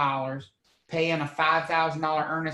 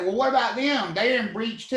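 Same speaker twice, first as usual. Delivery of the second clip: higher, louder mock protest; protesting party; 6 semitones higher, 3 dB louder than usual. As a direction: object like an aggrieved party in court. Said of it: "they're gonna say".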